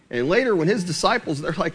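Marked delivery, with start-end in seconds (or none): none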